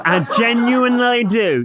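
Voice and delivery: high, loud squeaky echo; mocking high imitation. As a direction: parrot it back shrilly. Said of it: "that was so Wendy".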